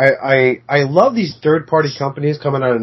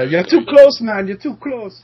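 much higher quoted sketch line; comedy sketch quote; 7 semitones higher, slower than usual. Retold or imitated. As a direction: play the sketch line up high. Quoting Chappelle sketch.

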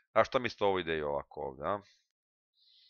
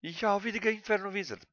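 mock squeal; 13 semitones higher, faster than usual. Spotting enemy card, mock surprise.

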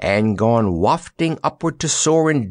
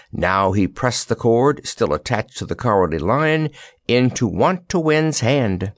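ordinary delivery, nothing marked

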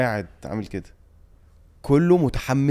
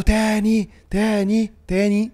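high-pitched imitation of a crowd chant; mock crowd chant, much higher; audience demanding encore; 11 semitones higher, 3 dB louder, much slower than usual. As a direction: call out like fans wanting an encore. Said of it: "الناس طلبوا مني أغني عارفة تاني".

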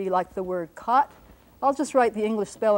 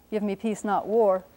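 usual speaking voice

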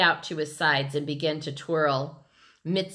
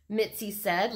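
ordinary delivery

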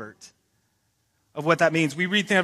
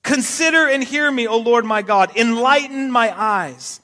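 preaching at full force; 3 semitones higher, 5 dB louder than usual. Acted. Pastor reads Psalm 13 aloud to the church, loud fervent preaching. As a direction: cry out the psalm to the congregation.

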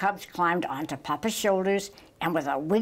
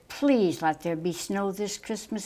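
usual speaking voice

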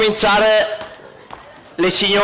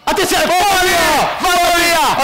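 breathless italian goal commentary; 8 semitones higher than usual. Acted. Breathless commentary of shot game.

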